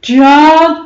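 loud gruff bear's first call; a bear calling out loud, suspicious. A narrator's reading. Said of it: "il se mit à grogner".

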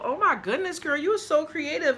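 high, excited gushing praise; delighted gush. Real, not acted. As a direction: gush over the handmade card with delight. Thrilled by handmade DIY card.

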